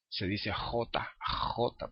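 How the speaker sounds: "J" as Spanish jota with a rasped first sound; drawn-out scrape on the back palate opening "J"; velum-scraping jota. Shown as a demonstration. Teacher showing the jota of Spain, scraped kh. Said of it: "raspando el velo".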